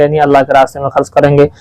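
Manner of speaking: usual speaking voice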